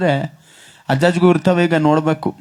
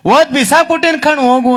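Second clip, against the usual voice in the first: put-on cheeky boy; 9 semitones higher, 4 dB louder than usual. Cheeky boy answering back.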